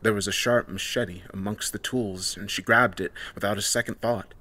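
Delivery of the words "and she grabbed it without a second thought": plain reading voice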